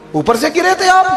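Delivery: pitched high in sarcastic disbelief; mocking jab. Real, not acted. Preacher mocks grown sons, high sarcastic. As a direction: needle them with a sneering question. Mocking rhetorical question to audience.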